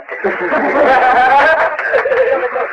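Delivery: amused laugh at your own words; real laugh. Real, not acted. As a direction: chuckle at the image.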